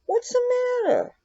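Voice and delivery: high cooing pet voice. Real, not acted. Owner cooing love at her cat, high baby talk. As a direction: lift it way up and coo.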